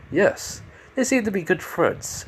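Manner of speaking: high, softly put-on voice; character's answer.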